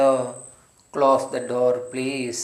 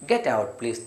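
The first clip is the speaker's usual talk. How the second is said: kindly; 3 semitones lower, much slower than usual. Shown as a demonstration. Teacher shows a polite, kind way to say it. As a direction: ask them gently. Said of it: "സ്നേഹത്തിലാണെങ്കിലോ".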